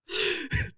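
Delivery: a sudden very high burst of laughter; high giggle. Real, not acted. Laughing at his own scheming, a high giggle. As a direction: let out a squeaky giggle. Laugh after the whispered line.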